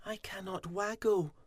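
a sad little bee's complaint; mild moan; Fuzzy moaning. A narrator's reading. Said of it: "poor Fuzzy moaned".

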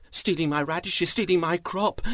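alarmed farmer. Acted. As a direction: blurt it out in rising alarm.

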